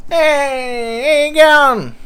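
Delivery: high, thin gangster voice; a weak, high-pitched tough guy; Chopper Reed impression. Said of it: "that didn't sound like Chopper Reed".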